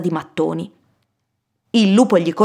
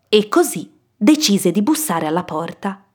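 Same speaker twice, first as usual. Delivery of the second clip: usual speaking voice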